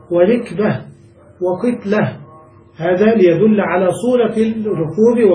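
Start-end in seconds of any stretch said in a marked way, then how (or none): 0.1-0.8 i-vowel in the first syllable of rikba; rikba, ri not ra; rikba with kasra. Shown as a demonstration. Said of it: "مكسور الفاء". 1.4-2.2 i-vowel in the first syllable of qitla; qitla, qi not qa; qitla with kasra. Shown as a demonstration. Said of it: "مكسور الفاء".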